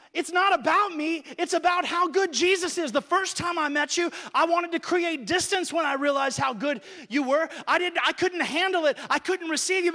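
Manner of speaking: high and loud, at full force; Peter's confession shouted at full force; preaching shout. Acted.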